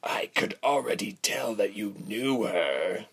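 understated villain scoff; mildly scornful coarse voice. A narrator's reading. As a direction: brush off the boy's confession. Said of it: "the man scoffed".

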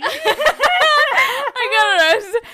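laughing. Real, not acted. Laughing retelling her arrest call.

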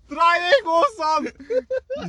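very high, loud imitated yell; teammates screaming. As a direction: scream the instruction at your keeper. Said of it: "mein Team schreien so".

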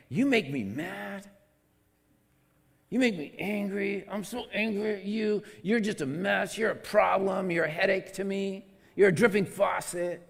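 hammed-up angry nagging; mock angry scolding. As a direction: scold like someone fed up. Acting out angry hurtful words.

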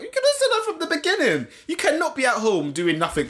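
high-pitched exasperated rant; fed-up, incredulous protest. Acted. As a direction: complain in mock outrage, pitch way up. Acted rant at evasive texter.

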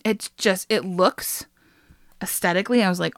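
ordinary talk, nothing marked

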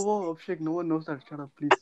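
soft embarrassed pleading; hushed. Real, not acted. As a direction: hush him, embarrassed. Embarrassed hushing friend.

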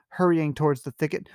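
normal talking voice

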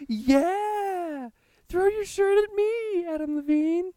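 very high, slow swooning squeal; screaming fangirl. Retold or imitated. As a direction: scream it up to the stage. Crowd screaming at singer.

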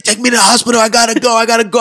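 high, urgent mock begging; mock panic. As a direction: imitate a friend pleading to be driven to hospital. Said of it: "He's like".

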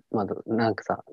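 usual speaking voice

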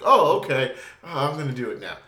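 loud hammed-up flustered Luke; frazzled Luke. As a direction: blurt it like a flustered young hero. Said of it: "Luke was, like, all frazzled".

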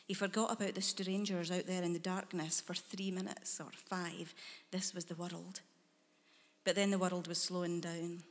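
usual speaking voice

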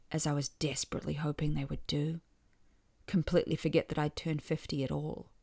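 plain reading voice

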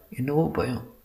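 very soft, hesitant confession; timid fear. A narrator's reading. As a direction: shrink back into a small, soft voice. Frightened bride confesses her fear. (பயத்தில் அடங்கிய குரலில்)

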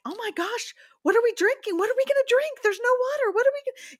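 very high-pitched mock panic; freaking out. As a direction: replay your panicked self in a squeal of worry. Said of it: "I'm freaking out".

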